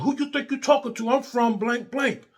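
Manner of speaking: high-pitched mock northern accent with attitude. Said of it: "You know, with the accent".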